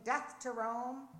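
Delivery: slow, punched revolutionary cry; narrated battle cry. A narrator's reading. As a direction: proclaim it like a firebrand, not a full screech. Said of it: "revolutionary with sword in hand screeching".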